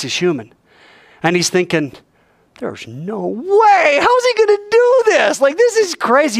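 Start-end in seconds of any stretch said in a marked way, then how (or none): 2.6-6.4 much higher, drawn-out incredulous cry; can't believe it's real; stunned disbelief. Retold or imitated. Peter doubting he'll find a coin, incredulous.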